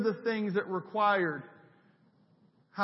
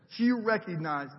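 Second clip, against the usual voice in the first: ordinary talk, nothing marked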